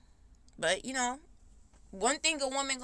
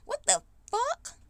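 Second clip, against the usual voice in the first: much higher, disbelieving what the fuck; incredulous outburst; 13 semitones higher than usual. Real, not acted. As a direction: react in shocked disbelief. Outraged at the elder's expectation.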